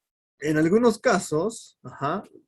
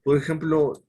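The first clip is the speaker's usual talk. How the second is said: ordinary talk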